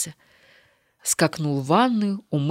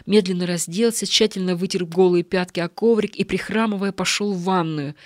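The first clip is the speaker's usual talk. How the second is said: ordinary delivery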